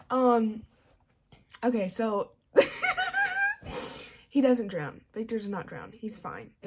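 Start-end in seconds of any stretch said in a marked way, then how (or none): 2.5-4.1 long high-pitched laughing fit. Real, not acted. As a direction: crack up helplessly, high and loud. Breaks into laughter mid-story.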